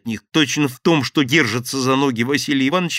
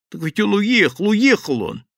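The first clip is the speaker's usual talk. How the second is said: high, loud frantic protest; desperate cry; 9 semitones higher, 5 dB louder than usual. Acted. Frightened thief protests loudly to the gang. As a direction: plead at full voice, terrified.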